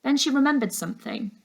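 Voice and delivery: ordinary delivery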